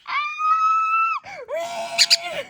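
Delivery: high, surprised shout of the name; real startled cry; excited shout. Real, not acted. Reacting excitedly to the comic.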